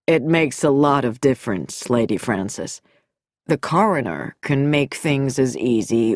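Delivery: low, smooth and measured doctor; doctor's voice. A narrator's reading. Nicholson's measured, smooth speech.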